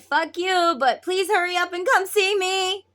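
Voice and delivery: high, loud mimicking voice; mock demanding feminine. Masculine quoting his feminine's message, high mocking imitation. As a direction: mimic a pushy, pleading partner. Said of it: "She said".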